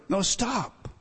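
usual speaking voice

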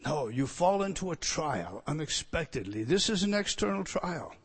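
soft, hushed preaching; quiet preaching. Acted. Preacher to congregation, hushed and intimate. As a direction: lean in and preach it softly.

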